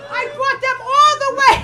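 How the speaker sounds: put-on high, loud shrieking old lady rant; screaming grandmother. Grandmother berating her comic grandchild after a bombed show, high screaming rant. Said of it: "Screaming on the top of her".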